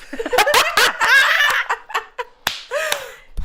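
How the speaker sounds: very high, loud laughing fit; real high cackle; big laugh. Real, not acted. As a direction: burst out laughing loud and long. Cracking up at own joke.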